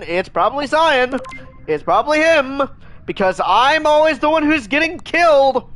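rising real frustration; building angry shout. Real, not acted. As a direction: blow up while blaming Cyan. Accusing, angry at dying.

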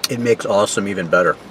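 speaking between bites; talking while chewing. Real, not acted. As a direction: mouth partly full of food. Seen 2: Holds bitten donut, chews between bites while talking.

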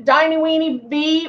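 usual speaking voice

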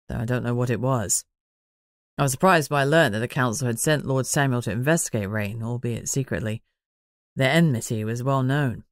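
lightly voiced pondering man; Manners, musing.

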